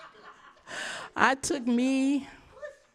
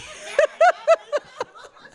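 a big high burst of laughter; loud high laugh; 8 dB louder than usual. Real, not acted. Pastor laughing with the congregation about being a crybaby.

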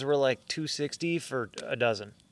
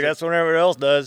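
hammed-up Kentucky accent, pushed up and loud; 5 semitones higher, 9 dB louder than usual. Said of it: "I'm gonna do my Kentucky accent".

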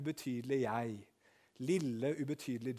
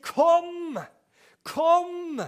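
ringing shouted call, much higher; 15 dB louder than usual. Acted. Pastor voicing God calling everyone, shouting. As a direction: call across a great distance.

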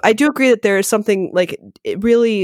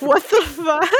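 a half-shouted, stunned what the fuck; retelling being shocked by a stranger's rudeness, mock outburst; 8 semitones higher, slower than usual. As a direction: replay your disbelief, a little raised and higher. Retold shocked reaction to rudeness.

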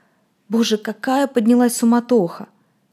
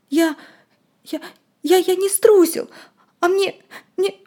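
stammering crying; 10 semitones higher than usual. Acted. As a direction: stammer it out through tears. Frightened boy crying, stammering.

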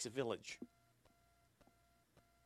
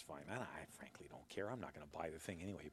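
whispering; 10 semitones lower, 16 dB quieter than usual. Real, not acted. Aside dropped to a whisper.

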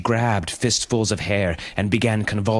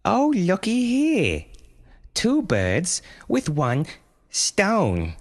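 put-on high, slow, taunting child voice; menacing boy; 13 semitones higher, much slower than usual. A narrator's reading. Eight-year-old boy taunting his mother with a boulder. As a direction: gloat over her like a bully kid.

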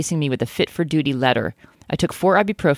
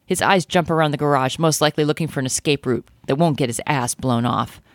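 ordinary delivery

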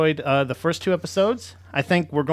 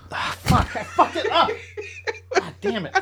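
high, helpless laughing squeal; laughing frustration. Real, not acted. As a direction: curse yourself through helpless laughter. Botched intro, breaks up laughing.

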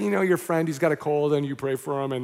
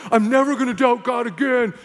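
higher, mock-confident declaration; retold zeal, pitched up; pumped-up; 4 semitones higher, 3 dB louder than usual. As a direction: act out a burst of overexcited faith. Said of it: "then I was all pumped".

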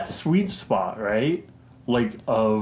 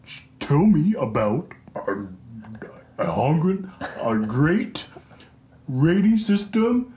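second stab at a hammed-up presidential voice; Donald Trump. Shown as a demonstration. Host asks co-host about the rating system, in Trump impression. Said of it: "That was a little bit more passable".

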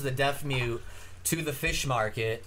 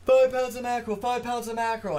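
put-on high strained voice of a deaf girl, much higher; 9 semitones higher than usual. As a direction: squeeze it up high and mushy like the deaf girl in the joke. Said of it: "she tried, you know, once again".

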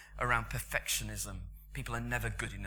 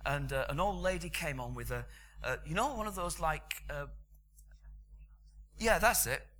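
ordinary talk, nothing marked

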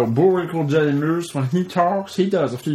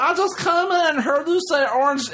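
put-on high girly chat voice; 14 semitones higher than usual. Reading Wendy's chat message in a squeaky woman voice. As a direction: squeal it like an excited young woman. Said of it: "she says".

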